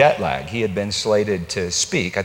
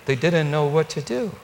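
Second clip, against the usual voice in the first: ordinary talk, nothing marked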